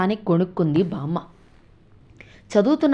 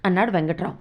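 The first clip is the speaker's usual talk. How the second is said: plain reading voice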